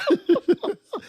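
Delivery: bursts into a high laugh; a high burst of real laughter. Real, not acted. Laughing at his own story. Laughs after acted quote.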